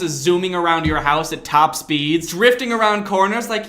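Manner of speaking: ordinary delivery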